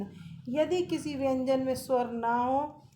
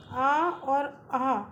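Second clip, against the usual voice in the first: slow demo; 3 dB louder, much slower than usual. Shown as a demonstration. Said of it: "ना तो स्वर है और ना ही व्यंजन".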